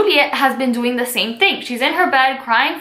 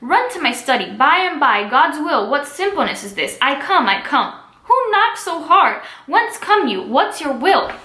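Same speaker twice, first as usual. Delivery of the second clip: raised, theatrical Shakespeare reading voice; dramatic play reading; 4 semitones higher than usual. Acted. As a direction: read the scene aloud with feeling for the class. Teacher reads play aloud.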